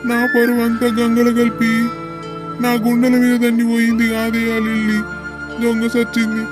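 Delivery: ఏడుపు. Acted. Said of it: "ఏడవకే బాబు". (ఏడుస్తూ శోకం పెడుతూ)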